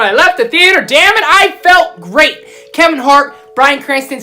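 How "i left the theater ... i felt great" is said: felt great. Real, not acted. Joy after leaving theater.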